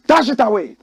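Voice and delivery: high, loud call to cut to the break. Real, not acted. Host calling out the break to viewers, loud. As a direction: shout it out like a showman.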